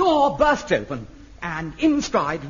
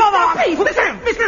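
flustered pleading; 12 semitones higher, 6 dB louder than usual. Real, not acted. Director calming a quarrel.

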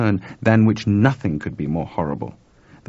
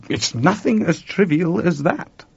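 slow, lofty monk voice, as if talking to a dim pupil; a lightly voiced condescending High Lama; Sam's queer look; 10 semitones higher than usual. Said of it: "looked at me in a very queer way".

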